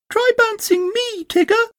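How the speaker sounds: very high, eager; eager Roo. Acted. Roo begs Tigger to bounce him, very high and eager. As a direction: beg like an excited joey. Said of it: "said Roo eagerly".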